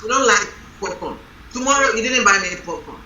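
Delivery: usual speaking voice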